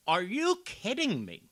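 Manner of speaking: loud, high disbelief; furious at the council clip; outraged. Real, not acted. Commentator yelling in outrage at the councilwoman. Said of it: "I'm yelling right now".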